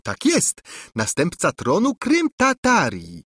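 a princess echoing her stunned cousin in mockery; mocking repeat. A narrator's reading. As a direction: parrot his words back to mock him. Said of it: "powtórzyła ... przedrzeźniając dalej".

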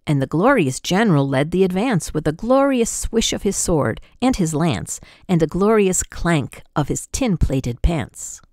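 ordinary delivery, nothing marked

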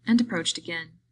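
usual speaking voice

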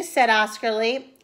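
ordinary delivery

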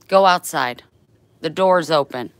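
loud, firm command; loud order. Real, not acted. Owner ordering her dog outside, loud and firm.

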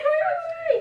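high burst of shocked laughter; shrieking laugh. Real, not acted. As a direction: laugh out in disbelief. Shock laugh after cutting bangs.

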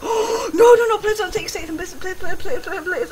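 high, rapid-fire begging; gasp, then rattle out pleas in a high stream; frantic pleading. Real, not acted. Player begging opponent not to take a word, frantic pleading.

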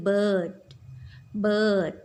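"bird" said clearly, drawn out; pronunciation demo. Shown as a demonstration. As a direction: model "bird" for children to copy. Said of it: "ภาษาอังกฤษก็คือ bird". (ออกเสียง "bird" ชัดๆ ให้เด็กพูดตาม)

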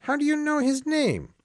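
put-on high, startled old priest; astonished priest. Priest asking the Marquis how he knows Julien, astonished. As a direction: jump up in pitch, caught off guard. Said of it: "The priest was astonished".

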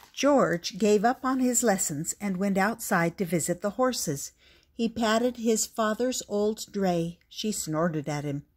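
usual speaking voice

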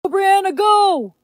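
high excited cheer. Acted. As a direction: egg her on like a fan. Cheering her on again.

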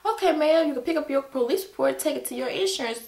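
a driver mimicking a curt state trooper, mock-polite. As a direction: parrot the trooper's brisk brush-off. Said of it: "that's all you gotta say is".

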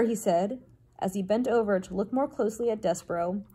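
plain reading voice

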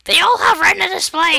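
high-pitched, exclaimed protest; mock yell. Friend interrupting his own phone advert, high mock yell. Mock outburst after deep-voice bit.

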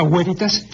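"veritas" as WÉ-ri-tas, with a W sound like the u of hueso; classical Latin veritas. Shown as a demonstration. Teacher demonstrating the classical reading to a radio listener. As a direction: start "veritas" with a W glide, first syllable stressed. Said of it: "la pronunciación clásica, veritas".

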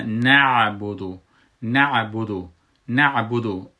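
نعبدو slow and loud, the final "du" kept short; crisp final syllable. Shown as a demonstration. Teacher drills the word, نعبدو with a quick, unstretched ending. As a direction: articulate the ayn in نعبدو, quick on the last syllable.

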